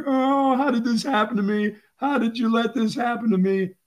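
high-pitched imitated whining wail; hammed-up high tearful complaint; mock crying. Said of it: "when he was, like, crying".

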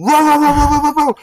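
high, loud mock roaring; imitated dog roar. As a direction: act out the dog lunging.

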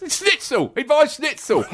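high, laughing through it; can't keep a straight face; high giggly retelling; laughing quote. Retold or imitated. Quoting butcher, laughing.

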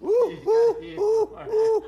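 high, loud falsetto ooh ooh; monkey hoot. Pretend. Mimicking a monkey for the interviewer, high hoots. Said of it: "says the monkey".

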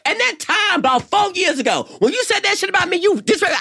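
high-pitched mock rant at a friend; shrill, fast drunk ranting; mock drunk accusation. Imitating her drunk self accusing a friend.

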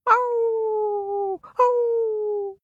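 high howling wolf cry. A narrator's reading. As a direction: howl like a wolf. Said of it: "He heard wolves howl".

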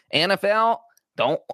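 a clumsy Texan owner's voice, begging not to play week one; terrible Jerry Jones accent. Retold or imitated. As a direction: ham up a rich Texas team owner pleading with the league. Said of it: "That is a terrible Jerry Jones accent".

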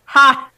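loud barked single ha; sharp mock laugh. Player voices the not-so-faint laugh, loud bark. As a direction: pop out a mock triumphant ha.

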